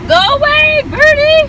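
high, loud desperate yell; frantic yell. Real, not acted. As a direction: shout it out in real panic. Begging bird to leave.

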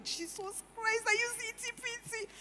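high, breathless squeal of delight; excited squeal. Retold or imitated. Retelling to her husband, a high excited squeal. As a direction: gush like a thrilled reader. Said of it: "You are very excited".

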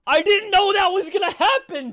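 high, loud, laughing protest; stunned disbelief. Real, not acted. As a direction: shout it high, half laughing. Stunned his prediction came true.

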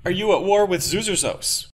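loud, high press questions called out; shouted questions. Acted. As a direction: fire questions from the crowd.

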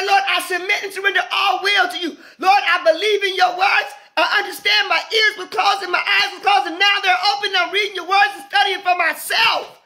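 high, driving preacher's shout; impassioned, sustained shout to God; fervent prayer cry. Acted. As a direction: cry out the surrender at full force, pitched high. Voicing a prayer of surrender.

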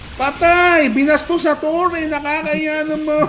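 high, drawn-out mocking call; gloating shout. Real, not acted. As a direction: crow over the kill, stretching the words. Pasigaw na pang-aasar sa napatay. (pasigaw na pang-aasar)

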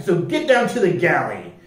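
plain reading voice

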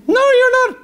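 a squeaky high, mocking voice for the flesh. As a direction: needle him high like a nagging imp. Argument between flesh and spirit.

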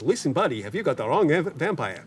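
punchline in a hammed-up Yiddish accent; thick Yiddish accent. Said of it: "in a very thick Yiddish accent".

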